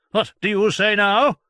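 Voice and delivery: high, loud triumphant physician; gloating doctor. Acted. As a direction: rub it in. Voicing Doctor John.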